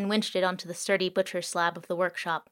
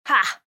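drone's short laugh; 5 semitones higher, 7 dB louder than usual. Acted. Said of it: "laughed the drone".

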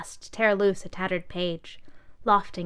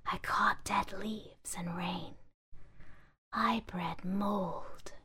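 whispering bag; 9 dB quieter, much slower than usual. Acted.

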